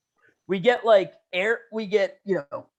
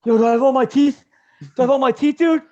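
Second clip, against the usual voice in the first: louder, higher dazed friend checking his teeth; dazed buddy; 5 semitones higher, 6 dB louder, faster than usual. Retold or imitated. Buddy after the crash asking about his teeth, dazed. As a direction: play the stunned buddy asking again and again. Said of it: "this narcissist says to me".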